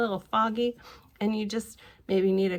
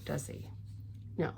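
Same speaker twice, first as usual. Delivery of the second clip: usual speaking voice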